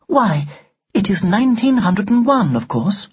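high, prim answer; haughty doll. Acted. Doll telling Ned the year, loftily. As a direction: state the obvious in a high voice.

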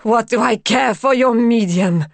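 loud, scornful outburst; harsh cry. A narrator's reading. Madame X turning on Raoul, crying out harshly. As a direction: throw it out harshly, with force. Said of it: "she cried".

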